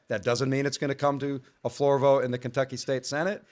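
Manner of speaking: ordinary delivery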